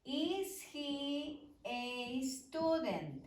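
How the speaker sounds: slow, careful English model sentence; teacher modelling an English question slowly. Shown as a demonstration. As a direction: lay it out slowly for the class. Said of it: "voy a hacerlo primero con el verbo to be y dice".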